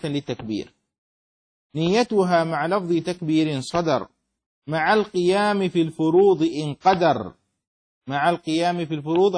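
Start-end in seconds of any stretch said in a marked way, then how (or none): 1.7-7.3 metrical didactic verse, firm line endings; verse recitation. Acted. Teacher reading the poem on prayer pillars, measured recitation. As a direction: recite in an even, emphatic meter.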